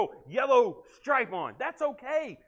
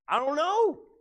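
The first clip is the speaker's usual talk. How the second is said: higher, louder "I don't know"; thief insists; 5 semitones higher, 5 dB louder, slower than usual. Retold or imitated. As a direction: insist you have no idea.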